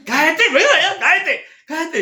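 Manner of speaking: high, laughing yell; hammed-up impatient urging, high-pitched; exasperated mock shout. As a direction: shout it comically, laughing along. Retold exasperated outburst at complainer.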